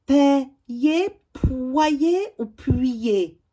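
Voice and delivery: PAYER, POYER, PUYER slowly, syllables stretched; sounded-out options. Shown as a demonstration. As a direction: offer PAYER, POYER, PUYER as slow choices. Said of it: "Complète les mots avec".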